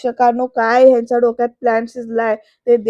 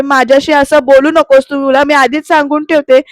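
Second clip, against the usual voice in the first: louder, scolding wife; a husband's rude remark draws an angry, louder reply; angry retort; 8 dB louder than usual. Retold or imitated. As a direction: snap back at him louder. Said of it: "आरती चिडून म्हणते".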